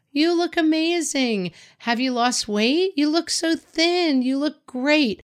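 high-pitched, drawn-out mock gushing; gushing compliments. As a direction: gush over a friend, stretching the words. Acting out women's gushing greetings.

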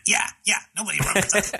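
high, fast snickering cartoon teen; Beavis and Butthead voice. Quoting Beavis and Butthead to a friend, snickering high. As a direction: giggle it out like a dim teen. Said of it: "Beavis and Butthead".

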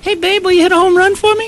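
put-on very high little-kid voice; imitated child fan. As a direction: squeak it like a pleading young fan. Voices child asking Babe Ruth.

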